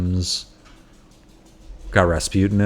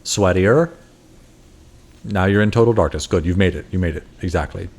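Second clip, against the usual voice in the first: mock hypnotist; 4 semitones lower than usual. Mock hypnosis voice over healer.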